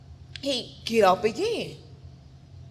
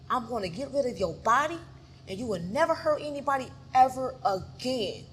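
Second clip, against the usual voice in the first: ordinary delivery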